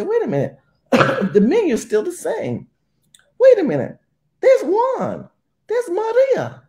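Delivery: high falsetto caricature of a stunned woman; shocked owner. Retold or imitated. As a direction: play the clueless owner finding out, way up high. Owner discovers the takeover.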